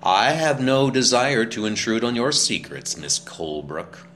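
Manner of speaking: loud, acrid retort, ending slow; acrid answer. A narrator's reading. Jealous spinster retorting to Naomi, acrid. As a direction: spit it out, wounded and acrid. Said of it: "With that acrid answer".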